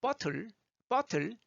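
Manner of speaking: bottle the British way with a clear T; British-style "bot-tul"; British pronunciation. Shown as a demonstration. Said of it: "영국 사람들은요".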